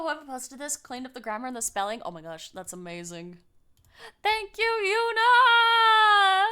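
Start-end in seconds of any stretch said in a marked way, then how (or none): 4.2-6.4 high, loud, long-drawn thank-you; gushing, drawn-out high holler; effusive thanks. Real, not acted. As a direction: call out thanks, stretching the name way out. Streamer thanks a supporter.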